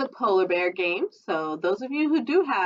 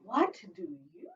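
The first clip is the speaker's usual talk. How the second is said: whisper; 8 semitones higher, 8 dB quieter, slower than usual. Acted. Whispered line in story.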